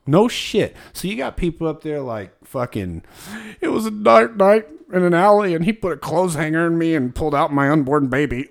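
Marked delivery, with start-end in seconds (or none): 3.6-8.5 higher, louder, hammed-up storyteller; mock dramatic confession. Imitating a classmate confessing in class, higher and louder mock drama.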